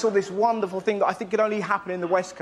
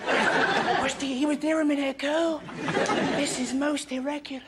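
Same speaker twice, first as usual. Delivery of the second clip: put-on soft, higher and a bit prim, like puzzled small boys; hushed, mock-proper children's voices; fussy little mates; 6 semitones higher, 4 dB quieter than usual. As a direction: play the friends as prissy kids looking for their mate. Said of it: "his mates appeared seconds later, like".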